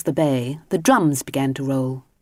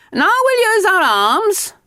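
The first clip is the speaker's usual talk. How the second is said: much higher, louder instructor calling across the pool; called out; 5 dB louder than usual. Acted. Instructor calling to Mister Grinling in the pool, high and loud. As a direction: call it out across the water. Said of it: "called the instructor".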